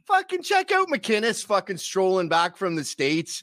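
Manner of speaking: exaggerated lilting Canadian accent; leprechaun-like Canadian accent. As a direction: imitate Canadian buddies who sound like leprechauns. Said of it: "all my friends sound like leprechauns".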